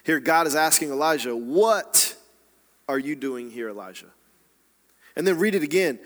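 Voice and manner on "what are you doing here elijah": "What" hit hard and held, the rest dropped lower; stress on WHAT. Shown as a demonstration. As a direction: punch "What", pause, then let "are you doing here, Elijah" fall away. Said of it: "Emphasize each word in this question".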